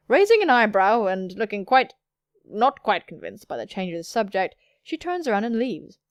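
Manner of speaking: ordinary talk, nothing marked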